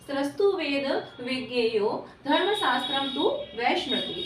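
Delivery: slow, measured shloka recitation; verse recitation. Real, not acted. Teacher quoting a shloka to students, slow recitation. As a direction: recite it word by word with drawn-out syllables.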